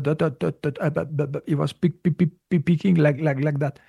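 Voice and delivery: stammering, repeating syllables over and over; imitated stutter. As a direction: get stuck on each word as if stuttering.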